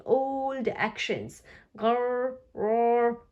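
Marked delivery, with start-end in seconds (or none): 1.8-3.2 growl. Acted. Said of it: "growls George".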